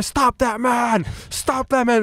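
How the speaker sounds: high, drawn-out bystander cry; hammed-up high-pitched holler; mock calling out. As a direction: call across the street in mock alarm. Mimics bystanders calling out across street.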